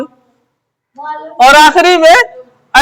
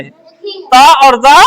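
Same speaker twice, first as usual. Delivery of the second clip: ता और जा drawn out very slowly, each word apart; slow teaching repeat; much slower than usual. Shown as a demonstration. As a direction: spell out ता और जा slowly so pupils catch it. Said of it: "ता और जा दोनों एक ही है". (धीरे-धीरे ता और जा दोहराना)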